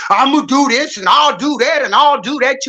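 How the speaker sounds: mocking a braggart. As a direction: imitate a blowhard making threats. Mocking bullies' empty threats.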